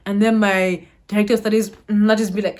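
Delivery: usual speaking voice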